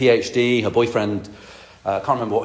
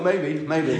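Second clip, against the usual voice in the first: laughing aside; 3 semitones higher, 5 dB quieter than usual. Retold or imitated. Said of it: "I thought".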